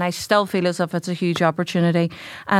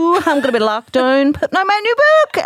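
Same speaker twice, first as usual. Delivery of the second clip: high, singsong playful boast; mock gloating; 10 semitones higher, 4 dB louder than usual. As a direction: brag jokingly as pitch climbs. Acting out gloating over lockdown.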